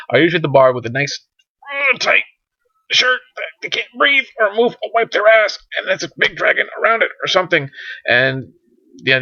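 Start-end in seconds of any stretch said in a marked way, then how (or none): 1.7-7.7 high-pitched squeezed voice, like a shirt too tight to breathe in; mock strained tight-shirt voice. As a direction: talk as if your chest is squeezed. Mimics man squeezed in tight shirt.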